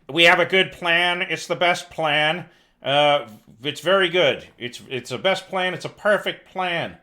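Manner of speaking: hammed-up Trump boasting, punching each claim; mock Trump. News commentator mocking the president's plan, mock Trump. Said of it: "That's his".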